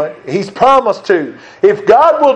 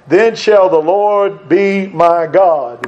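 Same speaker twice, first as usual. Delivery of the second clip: slow solemn reading; much slower than usual. Acted. Reading Jacob's vow aloud.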